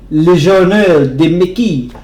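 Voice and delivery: Le Journal de Mickey said in an outrageous French accent, French vowels and a French r; hamming up the French title in a French accent; over-the-top French accent. Shown as a demonstration. Reading a French comic title in a French accent to viewers. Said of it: "I'm sorry for my French accent".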